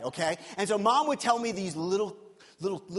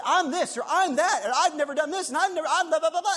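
mock boasting; 7 semitones higher, 4 dB louder, faster than usual. Mocking people's boasts.

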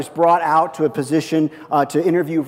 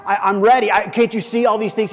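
higher, louder, stammering disbelief; stunned protest; 8 semitones higher, 5 dB louder than usual. Retold or imitated. Young pastor protesting to the senior pastor, stammering and stunned. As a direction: sputter it out, hurt and unbelieving. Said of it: "I said".

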